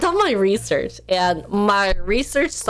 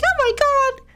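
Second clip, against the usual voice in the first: higher, put-on voice brushing chat off; hammed-up dismissive streamer; mock sassy self; 9 semitones higher than usual. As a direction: toss it off in a squeaky mock voice. Mock-voicing herself to chat.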